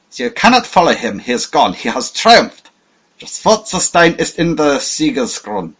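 high, loud fanatical crowing; triumphant gloating. A narrator's reading. Captured spy gloating at Hannay, loud and triumphant. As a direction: gloat with fierce pride. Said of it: "he cried".

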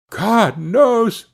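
high, bewildered exclamation; at wits' end. A narrator's reading. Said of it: "at his wits' end".

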